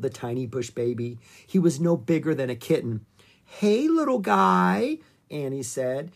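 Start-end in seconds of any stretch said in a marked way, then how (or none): 3.4-5.0 high, slow, sing-out hello; cooing greeting. Acted. Annie cradles the bush baby in her hands, cooing. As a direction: talk to a tiny pet, pitched right up.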